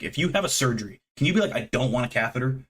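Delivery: ordinary talk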